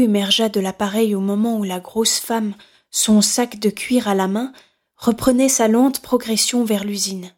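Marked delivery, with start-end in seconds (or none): none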